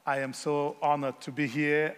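ordinary delivery

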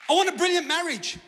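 high, loud hammed-up wish; mock eager declaration. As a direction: proclaim the dream with over-eager force. Acts out grand wish before deflating.

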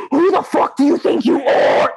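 outraged shout, acted out; angry. Moviegoer at the end of the movie, angry shout.

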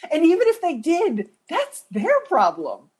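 ordinary talk, nothing marked

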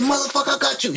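high-pitched mock outburst; going crazy. Mama answering her son's question about the limo, going crazy. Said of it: "She going crazy".

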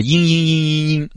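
high, thin imitated crying noise; mock whimpering. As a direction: parody a crybaby's sustained whimper. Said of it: "在那里哭". (模仿嘤嘤的哭声)

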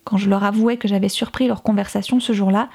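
ordinary delivery

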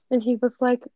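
ordinary talk, nothing marked

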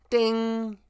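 high, sustained bell imitation; elevator ding. Storyteller imitating the elevator arriving, high ding. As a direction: sing out a high chime.

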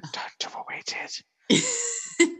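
slow, whispered mock crying plea; whimpered, whispered, hammed-up sobbing; mock sob. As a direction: beg tearfully in a hammed-up whisper. Parents pleading with their cool kid.

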